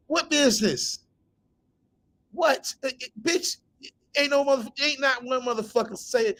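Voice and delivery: high-pitched, disbelieving outburst; scoffing, pitch jumping right up; incredulous challenge. Real, not acted. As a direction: question it in sheer disbelief. Mocks her businesswoman claim.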